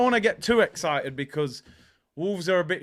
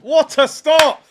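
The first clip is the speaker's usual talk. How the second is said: loud, high, slow exclamation; amazed shout; 7 semitones higher, 9 dB louder, slower than usual. Real, not acted. Streamer reacting to a save, amazed shout.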